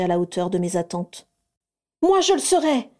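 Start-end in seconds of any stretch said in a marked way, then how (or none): 2.0-2.9 high, loud eager cry; eager outburst. Acted. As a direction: burst out with it. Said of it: "bondit Bellatrix".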